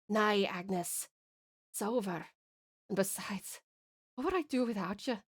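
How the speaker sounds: soft, sad and accepting; hushed Scottish-accented farewell; tender resignation. Acted. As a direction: give up quietly, with love. Dying lover refuses to leave her.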